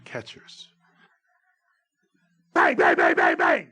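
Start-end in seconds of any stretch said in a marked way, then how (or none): 2.5-3.7 rapid-fire barked repeats; loud staccato umpire shout; shouted call. Shown as a demonstration. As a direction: fire the word off loudly, over and over.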